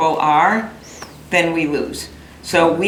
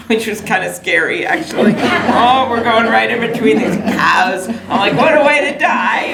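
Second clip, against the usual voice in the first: laughing through it, pitch jumping high; 6 semitones higher, 4 dB louder than usual. Real, not acted. Telling the crowd about the scary ATV ride, laughing.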